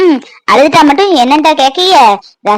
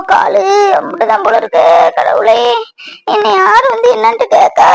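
fake pain crying; 3 semitones higher than usual. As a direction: wail and moan as if in pain, playing it up. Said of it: "அழுதுட்டு இருக்கா? கால் வலின்ட்டு?".

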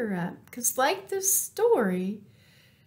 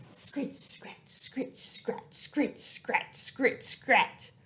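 very soft unvoiced scratch sounds; whispered scratching; 6 dB quieter, slower than usual. Sound effect of back scratch.

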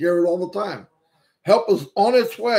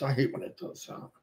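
muttered aside; 6 semitones lower, 11 dB quieter, faster than usual. Real, not acted. Annoyed aside to himself.

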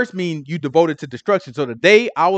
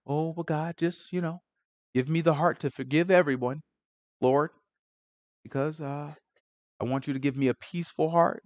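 much softer, prim and polite prayer voice; mock pretty prayer; 10 dB quieter than usual. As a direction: pray sweetly as if putting on a show. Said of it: "we be too pretty in our prayers".

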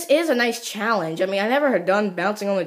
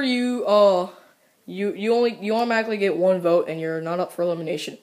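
ordinary talk

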